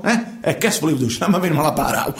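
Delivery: normal talking voice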